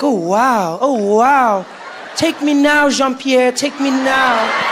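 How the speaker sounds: mock swoon, drawing out the last words; swooning admirer. Listeners melting at a French accent, mock-swooning. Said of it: "And we'll be like".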